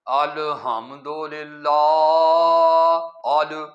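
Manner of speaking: الحمدللہ very slow and low, the ال stretched out like an opening sermon; teacher mimicking how preachers start a sermon, drawn out; imitating preachers. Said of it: "واعظین ... وہ کہتے ہیں".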